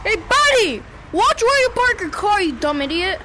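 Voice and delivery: high loud road rage shout; angry yelling. Real, not acted. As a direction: yell at a careless driver. Yelling at driver in game.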